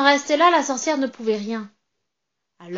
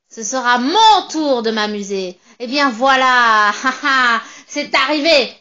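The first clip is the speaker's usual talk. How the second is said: loud, triumphant witch voice with a cackle; gloating witch; 6 dB louder than usual. Acted. Witch gloating over the trapped narrator, loud and cackling. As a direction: crow over your catch, louder and louder.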